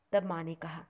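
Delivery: usual speaking voice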